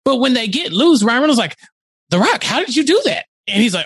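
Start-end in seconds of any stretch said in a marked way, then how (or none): 2.1-3.2 higher-pitched mock amazement; amazed question. Podcaster retells Reynolds asking how he got loose, amazed. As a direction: imitate someone baffled by a trick.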